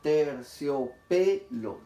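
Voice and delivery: slow syllable-by-syllable demonstration; sílaba por sílaba. Shown as a demonstration. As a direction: break the word "terciopelo" into slow, separate beats. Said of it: "Un golpe de sonido". (sílaba por sílaba, muy despacio)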